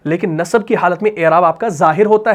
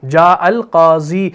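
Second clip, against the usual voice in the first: slow demo reading; 2 semitones lower, much slower than usual. Shown as a demonstration. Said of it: "یوں نہیں کہا جائے گا".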